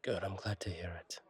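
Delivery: soft whisper. Acted. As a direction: whisper your relief to the customer. Whispered reply to customer.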